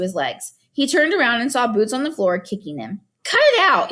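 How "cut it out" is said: sharp, higher angry snap; snapping. A narrator's reading. Gregor scolding little sister Boots, angry snap. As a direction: bite the words off at her. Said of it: "he snapped at her".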